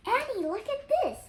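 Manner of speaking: high, loud eager call to his sister; bright shout of discovery, pitched high; excited call. Acted. Jack calls Annie excitedly.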